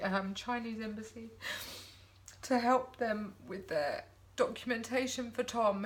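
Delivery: ordinary delivery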